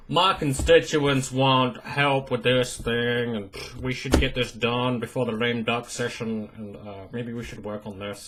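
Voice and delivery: mock a lazy congressman going through the motions; apathetic politician. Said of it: "I'm like".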